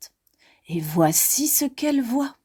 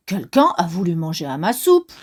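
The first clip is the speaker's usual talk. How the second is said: angry; 6 semitones lower, faster than usual. Acted. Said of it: "d'une voix fâchée".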